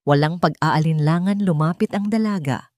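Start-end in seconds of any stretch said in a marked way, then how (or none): none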